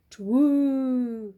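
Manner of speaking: loud owl hoot; owl toot. A narrator's reading. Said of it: "he tooted".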